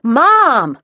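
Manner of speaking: loud high yell from upstairs; bellowed. A narrator's reading. Daughter bellowing for mom upstairs, loud yell. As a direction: bellow for your mother. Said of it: "Grace bellowed".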